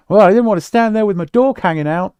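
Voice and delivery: high, loud movie-character impression; John Candy. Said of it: "listening to John Candy's voice".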